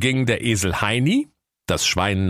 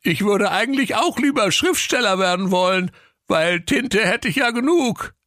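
eager octopus; 14 semitones higher than usual. A narrator's reading. Octopus agreeing with the parrot, high and eager. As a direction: chime in high and keen.

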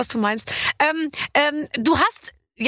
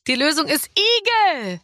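slow, drawn-out answer reveal, pitched higher; quizmaster reveal; 5 semitones higher, slower than usual. Pretend. Host reveals the quiz answer to the guest, drawn-out reveal. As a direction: draw it out like a game-show answer.

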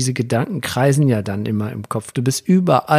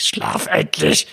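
slow, strained whisper-shout; exasperated; 3 semitones higher, slower than usual. Retold or imitated. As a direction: hiss it out at a child who won't sleep. Exasperated father at bedtime, retold. (genervt und gepresst gezischt)

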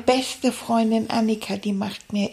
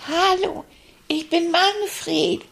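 put-on child voice; 7 semitones higher than usual. Child voice meeting Santa.

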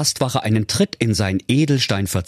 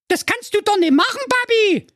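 hohe, gespielt entsetzte Mädchenstimme; leicht gespieltes Entsetzen einer Teenie-Prinzessin; entsetzt. Acted. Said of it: "rief Prinzessin Jacqueline entsetzt". (die Tochter ruft entsetzt zu ihrem Vater)

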